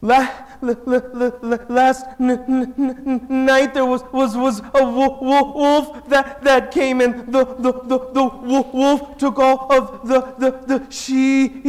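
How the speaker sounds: imitating a sobbing kid who gets stuck on every word; stuttering while crying. Weeping boy telling the old man the sheep are gone. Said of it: "that's what you guys sound like when you cry and talk".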